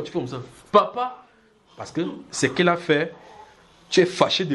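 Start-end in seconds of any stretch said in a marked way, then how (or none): 0.7-1.2 higher call of papa; child calling out. Retold or imitated. Child begging his father, pleading call.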